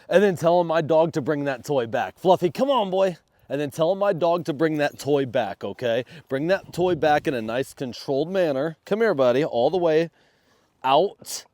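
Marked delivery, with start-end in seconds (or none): 2.2-3.2 talking to a dog. Real, not acted. Calling dog during play. 8.9-11.4 talking to a dog. Real, not acted. Commanding dog to come.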